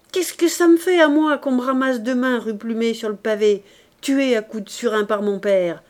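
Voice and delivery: put-on girl daring her father to kill her; bitter defiance. Éponine scorns death.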